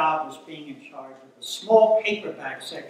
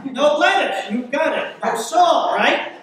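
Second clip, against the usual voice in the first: loud, raised-pitch order check; deli counterman; 9 semitones higher, 10 dB louder than usual. Acted. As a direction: fire the order back like a busy counterman. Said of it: "the counterman in a New York City deli".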